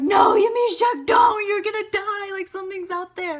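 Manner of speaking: high, loud mock-alarmed shout; high-pitched panic, easing off in volume; retold panicked pleading. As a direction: plead with a friend to stop, hammed-up.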